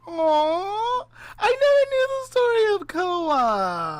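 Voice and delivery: moved almost to crying; touched aww. Real, not acted. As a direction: listener moved by the puppy story, high and teary. Touched reaction to puppy story.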